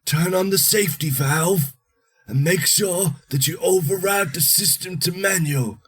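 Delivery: high, urgent voice rattling off steps; dying man's instructions. Acted. Dying man telling the hero how to stop the reactor, high and urgent. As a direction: gasp out the orders before you die.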